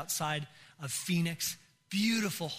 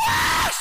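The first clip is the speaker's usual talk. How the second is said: very high, loud shriek; womanish scream; 13 dB louder than usual. Retold or imitated. Husband seeing scorpion coming at him, womanish scream. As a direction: scream in terror, comically high. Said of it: "I scream like a woman".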